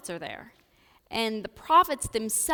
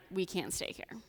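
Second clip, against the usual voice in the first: normal talking voice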